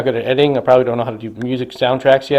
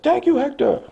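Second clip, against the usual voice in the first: much higher put-on soldier voice; Braverman's thanks; 13 semitones higher than usual. Braverman thanking Hector, high put-on voice. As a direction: pitch it way up as a grateful marine. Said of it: "Braverman goes like".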